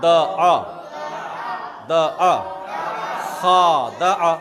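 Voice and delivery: द आ and खा spoken loud and drawn out; loud, deliberate syllable drill. Shown as a demonstration. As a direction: drill each syllable loudly for learners. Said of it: "फिर ऊपर आवाज़ है".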